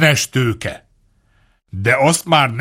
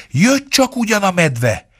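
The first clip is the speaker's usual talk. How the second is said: ordinary delivery, nothing marked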